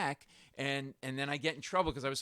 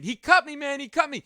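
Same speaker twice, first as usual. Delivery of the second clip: high, loud panicked scream; loud, high-pitched cry of alarm; 11 semitones higher, 9 dB louder than usual. Retold or imitated. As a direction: scream it out like a stabbing victim. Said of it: "he starts screaming".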